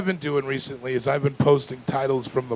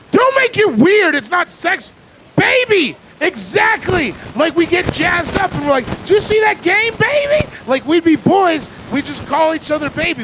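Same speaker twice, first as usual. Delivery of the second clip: jazzed up; 11 semitones higher than usual. Real, not acted. Excited bro talk about baby.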